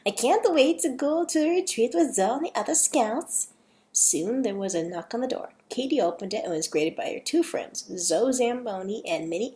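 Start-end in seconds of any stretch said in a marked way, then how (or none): 0.0-3.5 put-on higher Dutch girl, eager; amateur put-on Dutch accent; attempted Dutch accent. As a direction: look forward to it in a rough Dutch accent. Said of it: "sorry for my awful accent".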